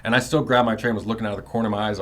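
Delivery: ordinary talk, nothing marked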